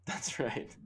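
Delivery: whispered, soft echo of the call; hushed agreement. Pretend. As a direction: breathe it like a secret confirmation. Echoing the bold prediction.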